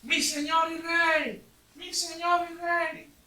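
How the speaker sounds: high, loud and slow calling; David calling after King Saul, slow loud high call. Acted. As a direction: stretch out the words to reach him far away. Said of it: "le empezó a gritar".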